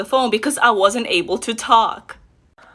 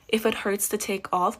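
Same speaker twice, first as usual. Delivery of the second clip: ordinary talk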